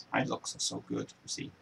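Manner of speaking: usual speaking voice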